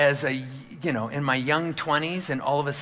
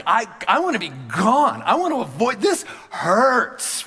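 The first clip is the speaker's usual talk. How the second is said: much higher and louder, pleading to escape; high pained plea; 7 semitones higher, 6 dB louder than usual. Retold or imitated. Young pastor pleading to leave his church, high strained voice. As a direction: cry out to be let go. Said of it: "my voice went up an octave".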